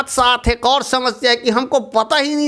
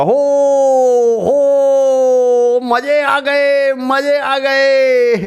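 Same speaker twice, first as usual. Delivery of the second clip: louder, slow happy whoops; joyful shouting; 5 semitones higher, 4 dB louder, much slower than usual. Acted. Relieved clod shouts happily after escaping the rain. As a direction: cheer with glee after the rain. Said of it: "खुशी से चिल्लाने लगा".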